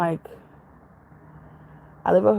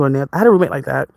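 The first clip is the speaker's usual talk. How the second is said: ordinary talk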